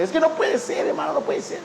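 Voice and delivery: high indignant cry, breaking into a strained half-shout; indignant protest. Retold or imitated. Offended sister protesting the pastor's apology, high and indignant. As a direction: protest in disbelief.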